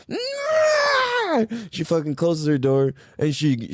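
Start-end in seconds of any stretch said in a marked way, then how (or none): 0.1-1.4 very high drawn-out squawk; exaggerated imitation of an angry screech; mock outraged screech. Line cutter losing it at the drivers, mock screech. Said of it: "she's like".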